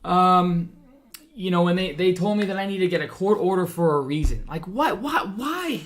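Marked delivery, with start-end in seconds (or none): 4.5-5.8 exasperated disbelief. Real, not acted. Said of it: "I'm so fired up".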